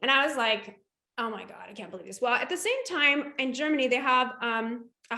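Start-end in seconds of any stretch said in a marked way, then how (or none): 1.2-2.2 hushed disbelief. Retold or imitated. Friend invites her to Denver.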